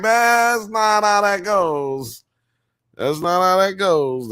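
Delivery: high, long drawn-out objection; mock protest. Recalling their own thought to listeners, high drawn-out protest. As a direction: stretch it out high, like a comic wail. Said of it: "I was like".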